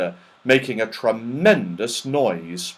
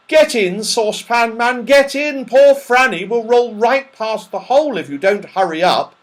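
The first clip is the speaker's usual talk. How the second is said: urgent shout; 11 semitones higher, 6 dB louder than usual. Acted. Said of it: "shouted Joe".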